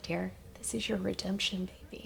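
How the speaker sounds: soft tearful whisper. Retold or imitated. Retelling her mother's tearful words, whispered. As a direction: breathe it out through tears. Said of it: "She was like".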